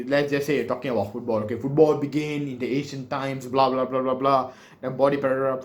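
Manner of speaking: usual speaking voice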